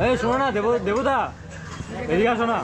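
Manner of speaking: high pleading call across the room; yelling across the room. Real, not acted. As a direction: coax him over from afar. Calling friend across room.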